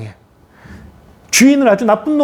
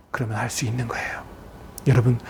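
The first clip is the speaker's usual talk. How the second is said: normal talking voice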